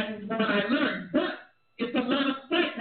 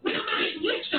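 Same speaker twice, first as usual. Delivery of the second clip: scornful shouted quote; 7 semitones higher than usual. Retold or imitated. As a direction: yell it like scandalized family. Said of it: "and they were like".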